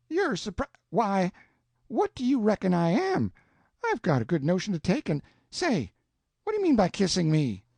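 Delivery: put-on Aunt Sally scolding the boy, sputtering with indignation; sputtering outrage. A narrator's reading. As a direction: splutter with indignation.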